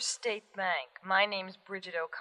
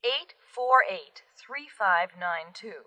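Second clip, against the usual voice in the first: slower, louder, each number spelled out; slow and clear; 3 dB louder, slower than usual. Shown as a demonstration. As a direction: repeat the number carefully. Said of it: "Say that again".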